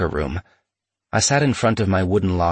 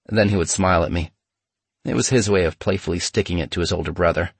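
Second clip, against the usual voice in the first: ordinary delivery, nothing marked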